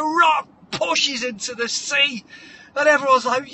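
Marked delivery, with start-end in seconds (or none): none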